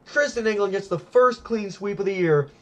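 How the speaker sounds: normal talking voice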